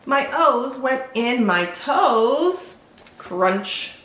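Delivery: slow, sing-song picture-book narration; children's story reading. A narrator's reading. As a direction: read it to little ones, savouring each rhyme.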